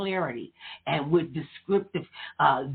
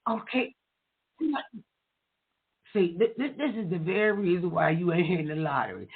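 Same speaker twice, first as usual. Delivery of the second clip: ordinary talk, nothing marked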